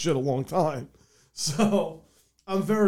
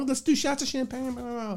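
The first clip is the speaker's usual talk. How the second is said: high-pitched, softer mock party squeal; giddy mom impression trailing into babble; hyped-up mom; 10 semitones higher, 6 dB quieter than usual. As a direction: imitate an excited mom rallying everyone, pitched way up. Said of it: "My mom got hyped".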